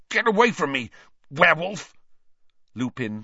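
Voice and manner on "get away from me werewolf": high, loud gasp of fear. A narrator's reading. Ron in pain as Lupin approaches, gasping. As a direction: gasp it out to drive him off. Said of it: "Ron gasped".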